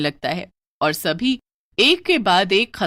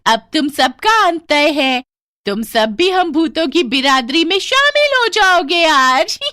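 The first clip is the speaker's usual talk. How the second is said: higher, louder, slow eerie proclamation; gloating ghost; 9 semitones higher, 9 dB louder than usual. Acted. Ghost woman announcing the family will join the ghosts, gloating. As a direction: announce their doom triumphantly. Ghost declares their end.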